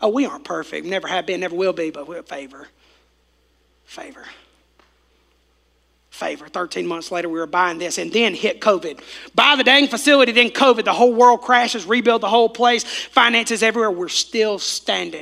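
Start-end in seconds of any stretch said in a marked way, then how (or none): 1.9-6.5 hushed repetition. Real, not acted. 9.3-13.9 loud building preaching. Real, not acted. Preaching at full force.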